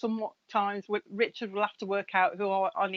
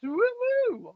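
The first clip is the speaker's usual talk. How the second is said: excited whoop. Real, not acted. Pleased surname said correctly.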